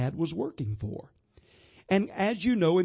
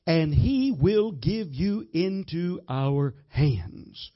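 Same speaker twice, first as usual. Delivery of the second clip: scripture reading; 2 semitones higher, 4 dB louder, slower than usual. Acted. Reads scripture declaration.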